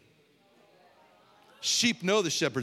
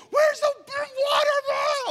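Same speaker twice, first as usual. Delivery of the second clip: very high, loud, slow shrieking yell; retold scream; 5 dB louder, slower than usual. Shoppers in the back of Walmart, a high panicked scream. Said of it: "I hear people screaming".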